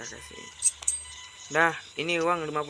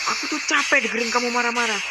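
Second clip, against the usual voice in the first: fed-up quarrel; 5 semitones higher, 5 dB louder than usual. Acted. Acted marital quarrel.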